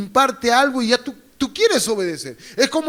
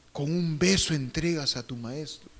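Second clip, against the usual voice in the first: quiet rebuke; 5 semitones lower, 11 dB quieter than usual. Retold or imitated. Jesus voiced, quiet rebuke.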